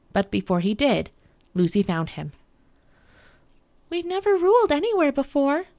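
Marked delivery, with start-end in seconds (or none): 3.9-5.7 put-on high girl's voice, mildly anxious; a bit afraid. A narrator's reading. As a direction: confess it in a high, hesitant child's voice. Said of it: "I am a bit afraid".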